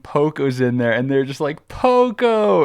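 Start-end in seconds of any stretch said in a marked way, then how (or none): 1.7-2.6 high, loud mock cheer of greeting; excited calling out. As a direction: imitate friends hailing a lost buddy. Friends call out on spotting him.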